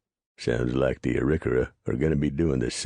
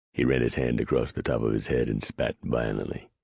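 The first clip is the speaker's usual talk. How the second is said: ordinary delivery, nothing marked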